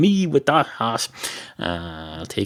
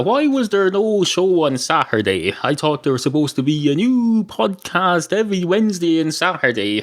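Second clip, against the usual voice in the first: imitating listener; 6 semitones higher than usual. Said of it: "I know what you're thinking".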